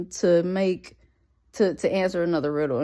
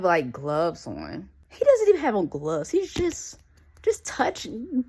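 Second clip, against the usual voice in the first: normal talking voice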